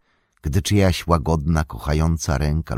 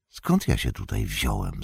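lower, softer, bewildered murmur; weak and dazed; 8 semitones lower, 6 dB quieter than usual. A narrator's reading. Frodo waking confused in the tower, faint murmur. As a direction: ask it faintly, lost.

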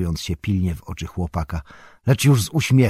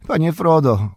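frightened cry; 9 semitones higher, 5 dB louder than usual. A narrator's reading. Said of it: "krzyknął sam, drżąc cały".

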